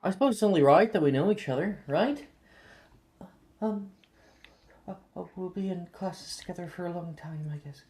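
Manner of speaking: put-on high, fast, trailing-off girl; shy girl voice. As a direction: rush it out shyly. Shy tall girl speaks.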